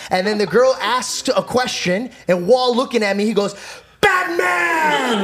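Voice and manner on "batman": very high, drawn-out mock shout; imitating a trolling heckler; defiant yelled answer. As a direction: holler it to provoke a friend. Friend yelling while staring at him.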